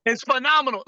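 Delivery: high, emphatic praise; rousing high-pitched hype line; energetic demonstration. Shown as a demonstration. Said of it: "You can say it".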